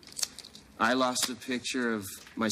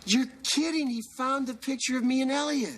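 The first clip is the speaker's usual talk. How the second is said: much higher-pitched, astonished outburst; amazed delight. Acted. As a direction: exclaim in happy disbelief. Told lost photo was found.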